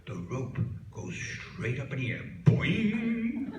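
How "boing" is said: high, loud springy boing; comic boing. Acted. Rope shooting straight up, high cartoon boing. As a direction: pop it out like a spring.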